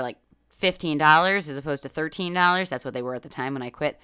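normal talking voice

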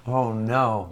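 normal talking voice

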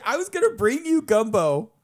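high-pitched hurt whine; mock-hurt high whine about the gumbo; whiny protest. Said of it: "was like".

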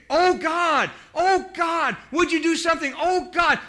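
high, loud desperate cry of "Oh God". Retold or imitated. Preacher voicing David alone in the cave, crying out to God. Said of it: "just crying out".

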